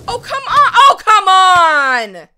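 very loud, high-pitched protest; exasperated yell. Real, not acted. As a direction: shout it in sheer frustration. Frustrated at repeated setback.

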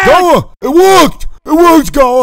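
high, loud triumphant shouting; excited triumph. Acted. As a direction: crow with delight at the success. Celebrating that the plan worked.

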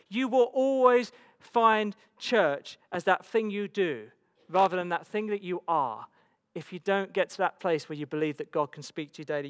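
ordinary delivery

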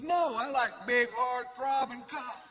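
friend's quote. Retold or imitated. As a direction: relish every word. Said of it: "He goes".

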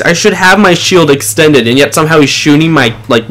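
usual speaking voice

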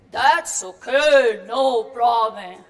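slow, loud, easy reply with a laugh; laughing reassurance. Acted. Tom reassuring Marianne about her remark, laughing. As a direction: laugh it off slowly.

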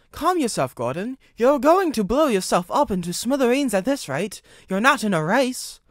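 high, loud telling-off; alarmed scolding. A narrator's reading. Driver calling to Gordon from the cab window, high loud scolding. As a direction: shout up at a reckless engine.

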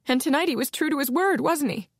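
she is cracking as she asks; frightened woman pleading for the truth; rising panic. Acted.

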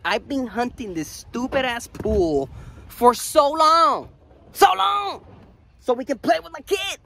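high, drawn-out emphatic rant; worked-up exasperation. Real, not acted. Ranting about his long search.

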